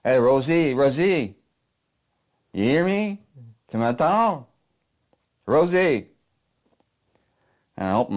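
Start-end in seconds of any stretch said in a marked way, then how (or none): 0.0-6.1 put-on high, loud French-accented calling. French pal rousing the knocked-out narrator, high urgent French voice. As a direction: call out to rouse him, French accent. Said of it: "this French voice says".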